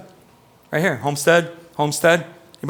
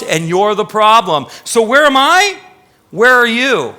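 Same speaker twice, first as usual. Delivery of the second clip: the Lord turning the question back on them, stern; God rebuking; 7 semitones higher, 8 dB louder than usual. Retold or imitated. The Lord rebuking his complaining people, loud stern preaching. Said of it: "the Lord said".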